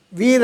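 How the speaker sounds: "వీర్" with long ee and sounded r; spelling pronunciation. Shown as a demonstration. Teacher shows ordinary spelling pronunciation, "వీర్" with sounded r. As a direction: say "వీర్" as spelt, r kept. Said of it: "మామూలుగా spell pronunciation".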